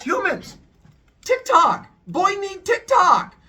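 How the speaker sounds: very high, loud broken-English plea; caveman demand. Acted. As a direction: grunt it out like a needy caveman. Zeus begs the humans.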